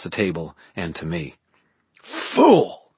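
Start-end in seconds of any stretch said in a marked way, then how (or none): 2.0-2.9 high, sharp venomous hiss; hissed insult. A narrator's reading. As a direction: spit the word at him. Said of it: "Aurora hissed".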